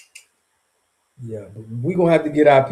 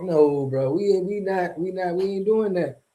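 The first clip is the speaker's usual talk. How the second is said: usual speaking voice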